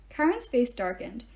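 usual speaking voice

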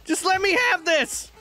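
high, pleading yells; desperate urging. Real, not acted. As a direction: beg the game to let you win. Final boss attempt.

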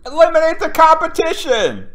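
booming high mock villain cry; villain proclamation. Mock tycoon roaring his motto to the crowd. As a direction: shout it like a cartoon tycoon.

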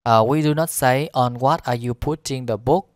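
ordinary talk

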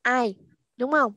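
normal talking voice

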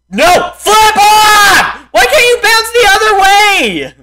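high, loud cry of frustration; anguished yell. Real, not acted. Gamer shouting at the bouncing cauldron. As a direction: plead and shout at the game. Raging at game.

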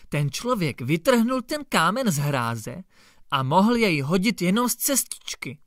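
ordinary delivery

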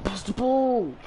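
shouting at the screen; exasperated high yell; frustrated shout. Real, not acted. Frustrated gamer yelling at teammates.

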